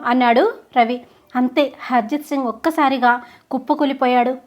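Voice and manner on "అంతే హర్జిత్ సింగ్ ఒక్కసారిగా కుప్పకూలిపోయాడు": plain reading voice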